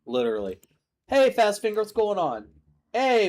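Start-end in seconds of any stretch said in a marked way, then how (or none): 1.1-3.3 excited greeting. Real, not acted. Greeting a chat viewer.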